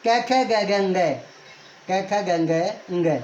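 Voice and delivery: slow recitation of "कै खे गै गै ngै", each syllable separate; syllable drill. Shown as a demonstration. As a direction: chant the letters slowly for students. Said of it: "चलो कैसे हम चलते हैं".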